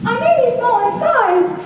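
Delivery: high, squeaky and proud; brave mouse declaration. Acted. Mouse Edwin answering the doubting king, high and bold. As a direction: declare it like a tiny hero standing tall. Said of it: "Edwin bravely declared".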